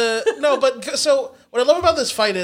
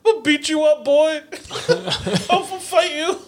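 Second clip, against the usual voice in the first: 80s wrestling heel; 6 semitones higher than usual. Retold or imitated. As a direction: bellow it like a pro wrestling villain. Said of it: "a nineteen eighties W W F heel".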